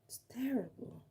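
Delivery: breathy hushed whisper, barely voiced. Real, not acted. To her viewers about her ugly eyes, whispered. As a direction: breathe it out like a shameful secret.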